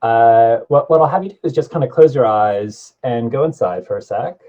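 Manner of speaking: normal talking voice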